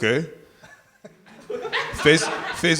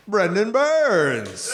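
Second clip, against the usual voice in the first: stretched, high announcer call of the name; announcer intro; 5 semitones higher, much slower than usual. Acted. Host bringing up his tag team partner in a stretched ring announcer call.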